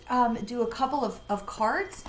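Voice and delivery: ordinary talk, nothing marked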